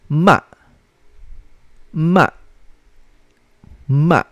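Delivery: short "Ma" falling sharply from high; fourth tone. Shown as a demonstration. Teacher shows students Mandarin fourth tone, "Ma" short and falling. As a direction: start "Ma" high and drop it quickly. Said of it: "pababa naman ang basa mo".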